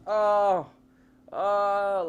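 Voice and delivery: mock pained groans, high and loud; groaning. Shot burglar groaning in pain, high and loud. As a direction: act out the wounded man's groans, high. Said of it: "guy's like".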